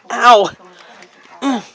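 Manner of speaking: ordinary talk, nothing marked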